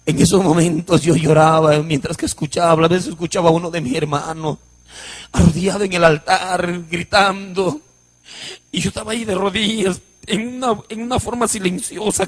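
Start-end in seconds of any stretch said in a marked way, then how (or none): none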